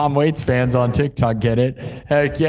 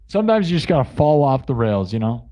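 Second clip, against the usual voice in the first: usual speaking voice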